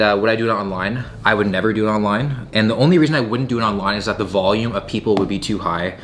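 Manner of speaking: usual speaking voice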